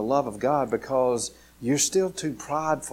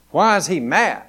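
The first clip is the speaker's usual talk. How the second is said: normal talking voice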